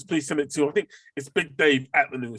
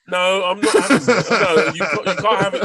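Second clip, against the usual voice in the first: loud, higher insistent objection; heated protest; 6 semitones higher, 8 dB louder than usual. Real, not acted. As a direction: refuse it with a laugh. Heated film argument.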